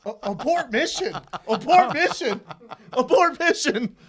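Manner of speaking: frantic half-laughing alarm that keeps rising; panicked. Retold or imitated. Fighter stuck punching out of a choke, frantic rising panic.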